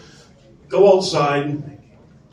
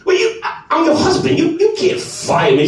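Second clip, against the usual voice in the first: high-pitched sputtering disbelief; retold high, stammering objection; indignant protest; 11 semitones higher than usual. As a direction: argue back like a flustered husband. Husband argues against being fired.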